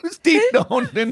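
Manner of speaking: high, drawn-out repeat through laughter; laughing. Real, not acted. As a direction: echo the name while cracking up. Cracking up at garbled name.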